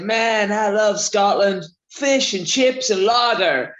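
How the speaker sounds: playing the clueless Tennessee guy, mildly; American tourist voice. Shop guy in Tennessee gushing about Scotland, light American accent. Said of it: "and he said".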